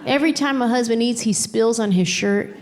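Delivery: ordinary talk, nothing marked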